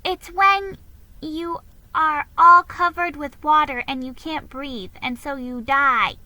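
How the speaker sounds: put-on high, loud child explaining; a child explaining drowning to her brother; little girl voice. A narrator's reading. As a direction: pitch it up like a girl who knows best. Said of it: "said Nan".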